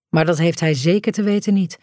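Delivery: usual speaking voice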